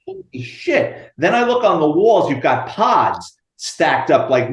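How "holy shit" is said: much higher, slow and drawn out amazement; mock stunned gasp of disbelief. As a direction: let the jaw drop on it. Discovering a basement full of roosters.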